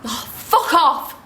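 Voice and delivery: high, drawn-out furious dismissal; angry outburst. Acted. Explodes at partner after confrontation.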